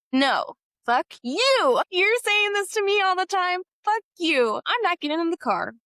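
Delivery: high, loud, indignant retort; angry refusal. Retold or imitated. Student refusing her teacher's car, high loud anger. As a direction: lash out in retold fury, pitch well up. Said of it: "You're really angry at this point".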